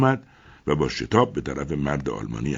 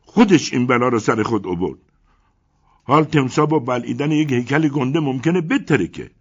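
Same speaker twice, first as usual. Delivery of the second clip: higher, louder angry yell; shouting; 8 semitones higher, 8 dB louder than usual. A narrator's reading. Crocodile owner shouting at the crowd, loud and higher. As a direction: shout the blame at her. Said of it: "صاحب تمساح فریاد زد".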